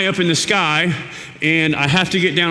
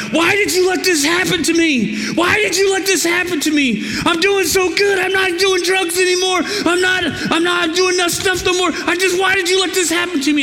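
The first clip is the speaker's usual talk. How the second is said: much higher, strained angry yell; yelling at God; 13 semitones higher than usual. Retold or imitated. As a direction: cry out in protest to God. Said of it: "I'm yelling at God".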